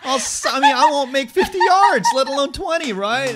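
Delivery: high, loud, laughing mock wail; hammed-up self-pity. Swimmer moaning to his coach friend, loud high mock wail.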